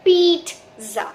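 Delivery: पिज़्ज़ा with a long double ee, peeetza; पिज़्ज़ा said peee-tza, ee drawn long; stretched ee. Shown as a demonstration. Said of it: "जैसे आप double ई की sound बोलेंगे".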